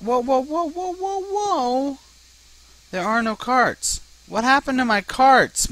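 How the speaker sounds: high, loud disbelieving whoa; shocked whoas. Real, not acted. Player finds his carts gone, shocked high cries. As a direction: cry out at the empty station in real shock.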